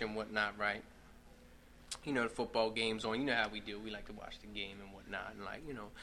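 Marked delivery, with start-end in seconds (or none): none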